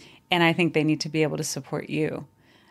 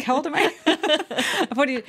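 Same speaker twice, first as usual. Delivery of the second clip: laughing at herself, pitch jumping higher; 9 semitones higher, much faster than usual. Real, not acted. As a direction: laugh through the words. Laughing at forgetting own age.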